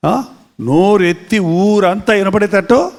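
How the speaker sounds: ordinary delivery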